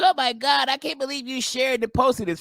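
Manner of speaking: high-pitched mock shock; outraged commenter. Comedian mocking commenters who object to the video, mock outrage. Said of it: "it's people in the comments".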